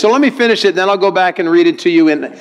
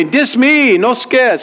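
high, sing-out Hawaiian Pidgin line; 8 semitones higher, much slower than usual. Acted. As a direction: call it out high in Hawaiian Pidgin for all to repeat. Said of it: "translation from our English into Hawaiian Pidgin".

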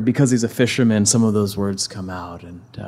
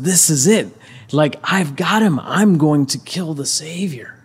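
raised, higher acted villain's exultation; triumphant gloating; 6 semitones higher, 3 dB louder than usual. Satan exulting as the Savior is beaten, triumphant gloating. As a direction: crow over a victory within reach.

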